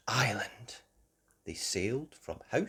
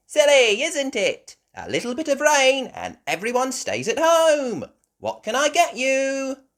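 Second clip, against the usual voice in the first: higher, louder chatty shopkeeper; Miss Rabbit voice; 14 semitones higher, 10 dB louder than usual. A narrator's reading. Miss Rabbit greeting customers, higher chatty voice. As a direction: chatter brightly like a busy shop lady.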